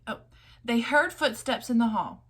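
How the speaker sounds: usual speaking voice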